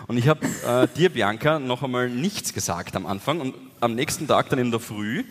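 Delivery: usual speaking voice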